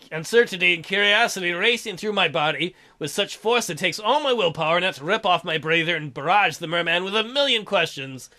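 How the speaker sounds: ordinary talk, nothing marked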